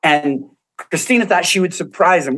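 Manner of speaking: ordinary delivery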